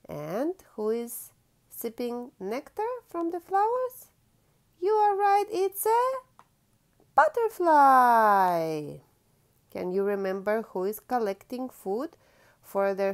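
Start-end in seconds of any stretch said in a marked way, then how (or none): 4.8-9.0 much higher, louder, slow cheer; thrilled praise. Acted. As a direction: squeal with joy at the answer. Praising child's answer.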